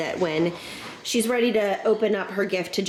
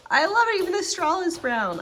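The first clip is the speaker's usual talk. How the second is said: high, excited gush over a new gift; delighted squeal; 12 semitones higher than usual. Real, not acted. Unboxing a tumbler she loves.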